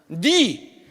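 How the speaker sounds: a drawn-out Gyeongsang 니, very slow; Gyeongsang dialect. Shown as a demonstration. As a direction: stretch 니 the Gyeongsang way. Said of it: "경상도 말로 니".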